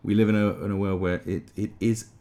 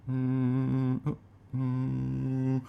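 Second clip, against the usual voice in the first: tense higher-pitched "mm"; simmering wordless hum of a man about to blow; angry grumbling hum; 6 semitones higher, much slower than usual. Acted. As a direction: hum with rising irritation. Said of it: "Angry short man is out".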